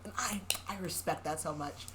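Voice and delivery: breathy whisper, barely there; whispered aside. Real, not acted. Reader confiding her approval of the hero, whispered. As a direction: lean in and whisper it.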